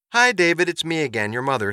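chirpy mother. Acted. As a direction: greet your son brightly, starting high. Mother starts yet another message.

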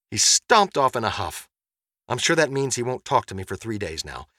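plain reading voice

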